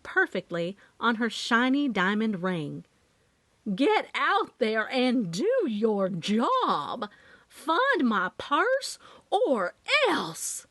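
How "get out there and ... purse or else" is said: slow, swooping Southern demand; indignant Southern lady giving orders; Southern outrage. A narrator's reading. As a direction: draw it out with a thick Southern accent. Robbed Southern woman orders the sheriff.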